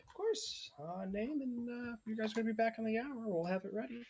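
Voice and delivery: cheery high voice greeting guests at the door; half-elf maiden. Acted. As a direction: lift way up into a chirpy maiden. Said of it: "She's like".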